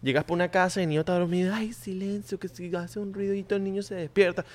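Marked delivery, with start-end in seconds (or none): 1.5-4.4 softer, higher hushed warning; hushing parent. Retold or imitated. Nervous parent hushing guests by sleeping baby, soft and high. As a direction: shush the room like a nervous parent.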